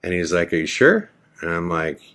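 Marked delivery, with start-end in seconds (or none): 0.5-1.1 high, rising questioning lift; questioning mimicry. Man in the alley checks if he is sure, questioning. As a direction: voice his doubt, pitch leaping up at the end.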